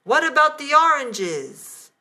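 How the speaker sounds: high, loud shout through the door. A narrator's reading. Husband shouting at the locked bathroom door. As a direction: yell it from outside the bathroom. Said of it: "I heard Robert's voice shouting".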